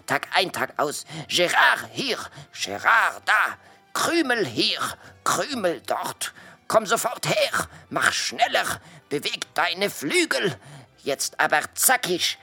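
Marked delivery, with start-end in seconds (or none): 1.3-12.4 raised, rapid-fire mock orders in French accent; imitating boss's yelling. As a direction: mimic the nagging boss, pitched up. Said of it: "er schreit mich ständig an". (den schimpfenden Chef nachmachen)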